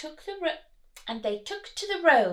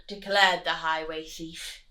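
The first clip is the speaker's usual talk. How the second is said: usual speaking voice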